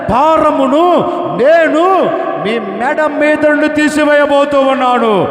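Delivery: high, loud, driving sermon cadence; preaching at full force. Acted. Pastor preaching to the congregation about their burdens, fervent. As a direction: preach it hard to the crowd.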